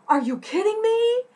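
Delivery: high, loud, thrilled disbelief; over-the-top delighted shriek; excited. Shown as a demonstration. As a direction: squeal it like amazing news.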